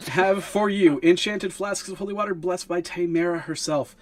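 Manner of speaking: high-pitched showman's sales spiel; rabble-rousing pitch. Acted. Stallholder inviting future customers to make a deal, rabble-rousing pitch. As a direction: beckon the crowd in like a market hawker. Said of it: "about to do a performance to get everybody's attention".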